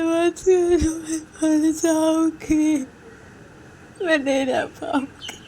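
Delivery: high, slow sobbing plea; weeping, drawn-out lament; tearful begging. Acted. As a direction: cry out that you'll die without her. Uma weeps, begging.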